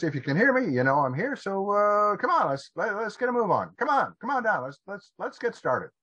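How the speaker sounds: high-pitched call to someone upstairs. Acted. Father calling up to his kid's room, high impatient call. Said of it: "So he calls up".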